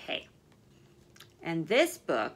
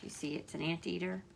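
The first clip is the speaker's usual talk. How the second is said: softer, settled storybook voice; a touch quieter storybook ending; softer reconciliation; 2 semitones lower, 8 dB quieter than usual. Acted. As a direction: wind down gently, making peace. Ending, characters softly agree.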